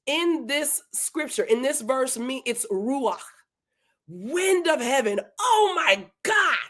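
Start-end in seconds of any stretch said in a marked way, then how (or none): 5.4-6.6 high, drawn-out exclamation of amazement; awestruck excitement. Real, not acted. Preacher marvels at wind of heaven in scripture, awestruck. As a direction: cry it out slowly, overwhelmed by the discovery.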